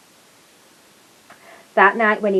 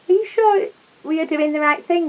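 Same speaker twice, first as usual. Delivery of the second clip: worried gasp; 7 semitones higher than usual. Acted. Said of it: "gasped Mister Gruber".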